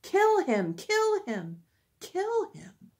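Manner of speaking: high crowd cry, easing in volume; yelling. A narrator's reading. Crowd still demanding death from Pilate, yelling. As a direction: voice a mob's high chant without full force. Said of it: "they still kept yelling".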